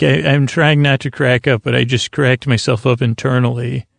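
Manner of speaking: usual speaking voice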